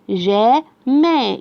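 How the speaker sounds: very slow جا ما, each syllable held apart. Shown as a demonstration. Teacher slowly sounding out syllables for a pupil. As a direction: stretch out جا ما for the child. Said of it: "انا قلت ايه؟".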